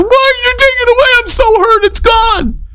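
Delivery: put-on very high, fast, wailing child voice; mock wail of a toddler whose toy was taken; whiny toddler. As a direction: play the toddler losing his mind. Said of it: "he starts losing his mind".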